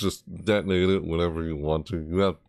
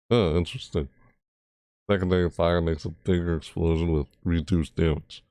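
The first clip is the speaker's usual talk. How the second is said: ordinary talk, nothing marked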